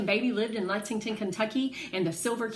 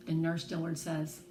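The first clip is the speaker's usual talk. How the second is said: ordinary delivery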